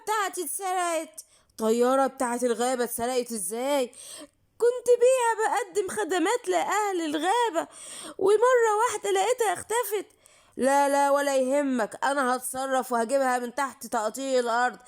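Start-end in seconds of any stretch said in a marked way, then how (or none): 4.6-10.1 high, tearful complaint about the loss; crying. Acted. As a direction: whimper it out, near sobbing. Plane stolen, he's upset.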